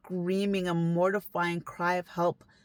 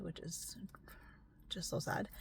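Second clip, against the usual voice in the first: whisper; 2 semitones higher, 16 dB quieter than usual. Real, not acted. Grieving aside.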